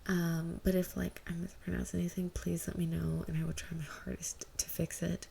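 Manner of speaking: ordinary delivery, nothing marked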